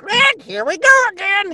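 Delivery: very high, loud sarcastic parrot; a parrot who has seen it all. Shown as a demonstration. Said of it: "He's sarcastic".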